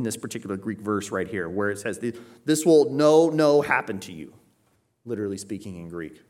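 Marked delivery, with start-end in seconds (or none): 2.4-4.3 "This will no" high and loud, then easing; emphatic double negative. Shown as a demonstration. Peter literally speaking in Greek, emphatic on "no". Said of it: "Peter's being emphatic".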